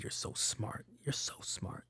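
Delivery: whispered sarcastic praise; mock whisper. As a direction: breathe it out sarcastically. Mock praise for the props team.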